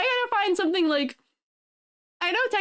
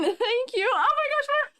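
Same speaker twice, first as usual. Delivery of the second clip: ordinary talk, nothing marked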